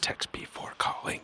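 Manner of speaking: very soft unvoiced-breath whisper. Real, not acted. Co-host slipping in a show name, whispered. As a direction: whisper it under your breath.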